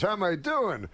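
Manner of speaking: high-pitched, exasperated comic comeback; Rodney Dangerfield. Retold or imitated. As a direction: imitate an indignant old comic throwing the question back. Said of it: "And Rodney goes".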